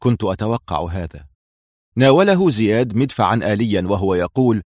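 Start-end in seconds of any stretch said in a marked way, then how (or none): 0.0-1.3 low, composed and unruffled; calm. A narrator's reading. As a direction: take the news coolly, pitched down. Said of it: "في هدوء وقال".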